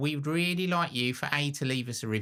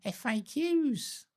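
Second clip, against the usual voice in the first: playful high; much slower than usual. Pretend. Playful repeat of acronym.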